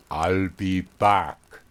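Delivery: put-on very slow German-accented I'll be back; menacing German-accented line. Big ponytail man leaving the store, slow German-accented threat. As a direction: let each word hang, heavy German accent. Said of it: "I kid you not".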